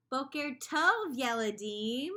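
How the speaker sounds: slow, loud cheerful call; loud greeting. Acted. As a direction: call good morning to the class. Loud morning greeting to children.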